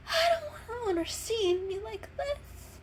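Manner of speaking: high, slow, near crying; tearful. Acted. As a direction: say it holding back tears. Sick cat voice, tearful.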